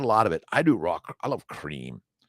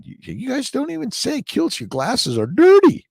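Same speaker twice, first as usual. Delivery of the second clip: mock viewer voice; 8 dB louder than usual. Mocking viewers' comments.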